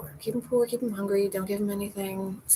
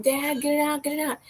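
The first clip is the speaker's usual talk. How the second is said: mock panicked plea; 6 semitones higher than usual. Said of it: "I'm like".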